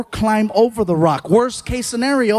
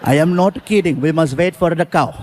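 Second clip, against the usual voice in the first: mock Indian driver; 4 semitones lower than usual. Driver's reply voiced.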